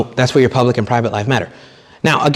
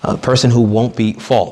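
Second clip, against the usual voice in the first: ordinary talk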